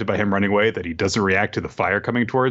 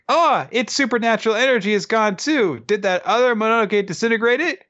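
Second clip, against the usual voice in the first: higher surprised question; 11 semitones higher, faster than usual. Retold or imitated. Kusanagi surprised.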